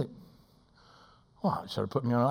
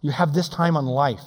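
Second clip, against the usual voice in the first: ordinary delivery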